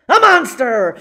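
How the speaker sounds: loud dramatic announcement; excited reveal. A narrator's reading. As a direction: shout out the reveal. Monster reveal in story.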